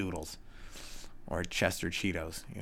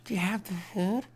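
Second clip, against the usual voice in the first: higher cat begging voice; 11 semitones higher than usual. Retold or imitated. Cat begging the owner at dawn, higher. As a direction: beg for food as the cat. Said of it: "every morning for food".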